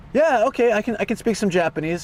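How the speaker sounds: keen applicant brightening up; hire's eager voice. Retold or imitated. A hire claiming he speaks Japanese, mildly high. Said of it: "He went from, like".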